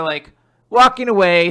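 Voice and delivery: ordinary talk, nothing marked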